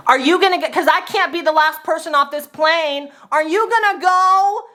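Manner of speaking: high, insistent mock-loud demand; loud passenger. Passenger afraid of being last off the plane, mock loud. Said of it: "she was being so loud".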